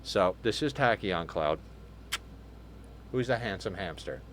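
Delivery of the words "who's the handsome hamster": softly cooing to the hamster; tender, softer coo for a little pet. Real, not acted. Owner cooing softly to the pet hamster: tender pet talk.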